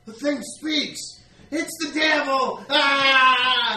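high terrified shout; panicked villager. Acted. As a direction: scream that the devil is here. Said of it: "He's like".

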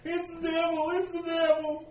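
high panicked cries; fleeing villagers. Acted. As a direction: yell it while running away. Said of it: "four guys run past you going".

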